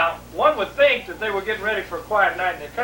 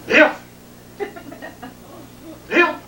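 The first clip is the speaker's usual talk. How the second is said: Dad calling out; 11 semitones higher than usual. Retold or imitated. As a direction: call out like a needy dad in bed. Dad calling son from bed.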